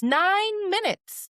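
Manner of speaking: high, slow stress on Nine; Nine said high and slow, amazed; incredulous emphasis. Real, not acted. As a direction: drag out Nine in disbelief. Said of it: "emphatic retelling".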